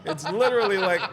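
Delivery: giggling confession; laughing. Real, not acted. Laughing about fried chicken craving.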